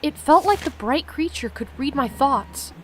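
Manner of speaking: steady read-aloud narration of the game text; reading the story to viewers, lightly lifted. Acted. Streamer reads the game's story to viewers, steady narration.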